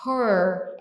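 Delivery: "her" said with a closed vowel. Shown as a demonstration. Teacher repeating the closed vowel of "her". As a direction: keep "her" tight and closed. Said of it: "é fechado".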